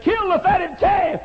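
high, loud, slow cry of welcome; father's joyful shout. Acted. Father welcoming his lost son home, joyful shout. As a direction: call out the feast like the overjoyed father.

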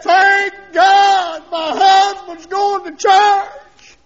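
very slow, high, loud holler; joyful shout. Retold or imitated. Wife rejoicing at husband's churchgoing, shouting. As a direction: shout praise with every word stretched out. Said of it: "my wife literally shouted".

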